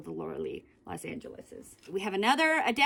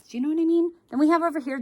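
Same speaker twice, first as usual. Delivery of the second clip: higher, louder eager chat; excited; 6 semitones higher, 7 dB louder, much faster than usual. Real, not acted. Asking viewers eagerly, higher and louder. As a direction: ramp up the excitement.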